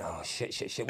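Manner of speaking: low, soft cursing to yourself; worried inner voice. Acted. Co-driver fretting over what he has said wrong, worried and quiet. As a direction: mutter it under your breath.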